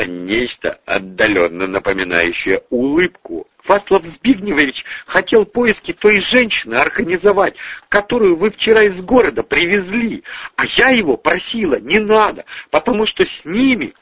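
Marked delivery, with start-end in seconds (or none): 3.6-13.9 high-pitched, urgent woman's voice; earnest explaining. Acted. Tamara explains herself to the men, high and urgent. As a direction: gush it out in self-justification. Tamara pleads her case excitedly.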